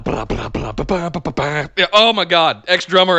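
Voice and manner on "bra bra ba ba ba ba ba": rapid-fire vocal riff imitation; imitated instrumental hook; mouthed music riff. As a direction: punch out the riff in repeated syllables. Mimicking an entrance riff by mouth.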